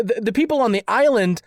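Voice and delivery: ordinary talk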